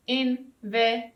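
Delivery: "Invitation" broken into four even beats, in-vi-ta-tion; syllable by syllable. Shown as a demonstration. Teacher counting four syllables for the class, "Invitation" in separate beats. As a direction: count out "Invitation" with a small gap after each syllable. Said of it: "one syllable, two syllable".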